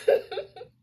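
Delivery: high, laughing through the words; real amused cracking up. Real, not acted. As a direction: let the laugh take over mid-sentence. Laughs at the tangled plot.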